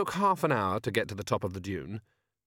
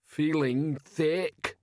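slow, heavy troll voice; slow dazed troll; 5 semitones higher, much slower than usual. Acted. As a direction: drag it out like a groggy troll. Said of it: "he muttered".

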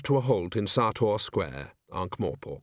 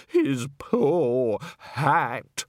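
slow, grieving woman's voice; sad; 3 semitones higher, much slower than usual. A narrator's reading. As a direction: mourn the poor hat slowly. Distraught woman in story.

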